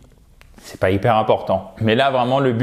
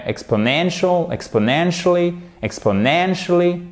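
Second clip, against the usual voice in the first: "exponentially" higher, NEN punched; stress on NEN; 6 semitones higher than usual. Shown as a demonstration. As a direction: drill "exponentially" at raised pitch, main stress on third syllable. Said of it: "où se trouve l'accent tonique".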